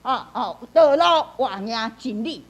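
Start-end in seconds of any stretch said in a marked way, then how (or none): 0.7-1.3 道路 hit high and loud; emphatic. Shown as a demonstration. Teacher demonstrating where to add emphasis, stressed 道路. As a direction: punch the word 道路. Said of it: "你用加强".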